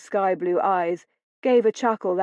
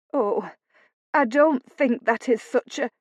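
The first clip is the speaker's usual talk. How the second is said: mild, higher-pitched whimper; 5 semitones higher than usual. A narrator's reading. Housekeeper tries to stop her mistress, mildly whimpering. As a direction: feebly try to object. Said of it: "Missus Hodge whimpered".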